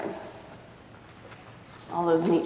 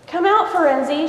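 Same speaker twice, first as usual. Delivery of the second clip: higher, carrying call into the bushes; 8 semitones higher, 8 dB louder than usual. Acted. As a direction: call out across the garden. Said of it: "shouted Legs".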